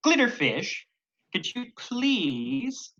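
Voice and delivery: usual speaking voice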